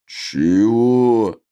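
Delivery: long, drawn-out orc bellow; indignant roar of a brutish orc. A narrator's reading. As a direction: roar it out as one stretched word. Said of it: "заревел тот".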